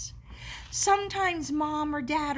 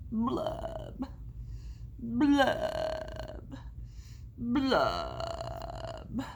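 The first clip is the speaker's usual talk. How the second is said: mopey blub; 3 semitones lower, 3 dB quieter, much slower than usual. Acted. Fish bubbling sound.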